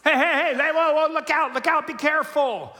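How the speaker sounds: loud, high, laughing warning shout; laughing alarmed holler. Retold or imitated. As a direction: yell at a kid running into traffic. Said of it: "what are we hollering".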